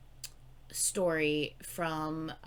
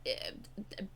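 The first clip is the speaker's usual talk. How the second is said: a long, quiet, emotional sigh; 9 dB quieter than usual. Real, not acted. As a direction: let out a heavy sigh. Moved by the song.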